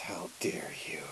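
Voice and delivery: horrified whisper; stunned whisper. Acted. Sister whispers at her brother in horror. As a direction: breathe it out in shock.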